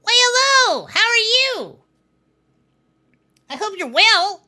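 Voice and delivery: hammed-up sly evil greeting; villain greeting. VTuber character greets viewers, high sly villain voice.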